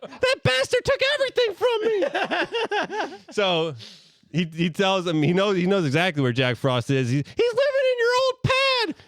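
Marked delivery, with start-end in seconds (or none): 0.1-3.2 tiny, high cookie voice; little voice. Acted. Gingerbread man popping out of the shadows, tiny high voice. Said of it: "And then Ginger says". 7.2-8.9 tiny, high cookie voice; little voice. Acted. Gingerbread man popping out of the shadows, tiny high voice. Gingy telling Santa.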